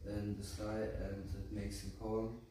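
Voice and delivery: plain reading voice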